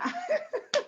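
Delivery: a high burst of laughter. Real, not acted. Host delighted by Gary's testimony, high laugh.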